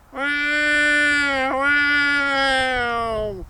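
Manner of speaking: high, long-drawn tomcat meow; pitiful cat yowl. A narrator's reading. Old tomcat up on the rail fence, pitiful high yowling. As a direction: wail like a sorry old tomcat. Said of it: "You sound plumb pitiful".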